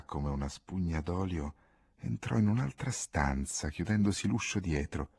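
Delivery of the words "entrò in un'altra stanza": ordinary delivery, nothing marked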